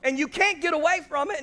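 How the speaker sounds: loud, high preacher's cadence; full-force preaching. Acted. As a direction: drive the point home to the crowd.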